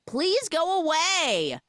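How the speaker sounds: high, slow mock plea; mock male voice. Retelling a film to a co-host, high drawn-out imitation of a male voice. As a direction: draw it out high and pleading. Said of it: "we hear a male voice say".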